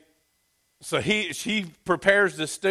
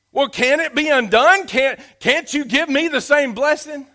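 desperate plea; 10 semitones higher, 8 dB louder than usual. Acted. Esau pleading with father.

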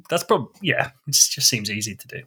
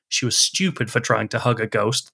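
ordinary talk

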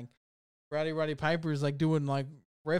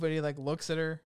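ordinary talk

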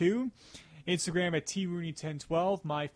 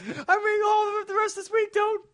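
shrill pleading fan voice; high excited plea; 5 dB louder than usual. Retold or imitated. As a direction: squeal it in a panic. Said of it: "she's like".

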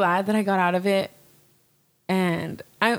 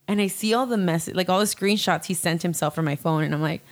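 normal talking voice

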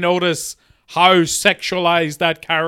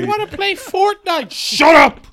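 put-on much higher bratty kid whine; whiny kid; 8 semitones higher than usual. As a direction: whine like a sulky child. Kid voice in mock dad scene.

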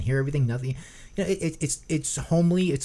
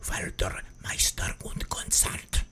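slow, creepy whisper in German; 3 semitones lower, 4 dB quieter, much slower than usual. Shown as a demonstration. Showing co-host how creepily Germans whisper, creepy whisper. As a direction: whisper it slowly like a sinister German. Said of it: "when they whisper creepily".

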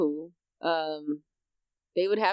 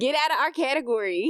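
raised-pitch playful send-off; mock indignant dismissal; 6 semitones higher than usual. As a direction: order them out in mock outrage. Acted gatekeepers chasing outsiders off.

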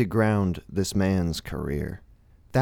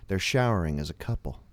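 normal talking voice

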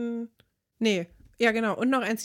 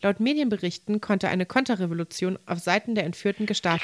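breaking news. Acted. News report with music bed.

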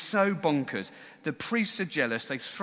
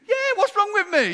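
very high, loud mock indignation; hammed-up wounded retort; defensive outburst; 10 dB louder than usual. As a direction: protest it in a comic high yell. Acts out defensive reply to disbelief.

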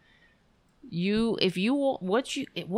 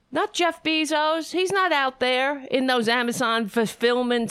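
indignant rant; 6 semitones higher, 4 dB louder than usual. Real, not acted. Ranting against Bezos.

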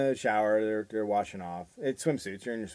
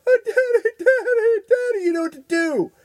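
put-on much higher, louder desperate child; mock wailing little girl; 9 dB louder than usual. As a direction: wail for Daddy. Girl crying for daddy.

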